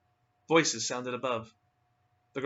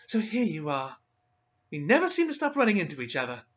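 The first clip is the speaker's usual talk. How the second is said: higher, lightly mocking young woman; Jacqueline's voice; 6 semitones higher, 6 dB louder than usual. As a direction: greet them with a sly edge. Jacqueline taunts the couple.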